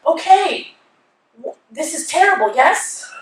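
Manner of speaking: high, tentative imitation of him; imitated boyfriend. Girlfriend mimics him seeing the sign, high uncertain agreement.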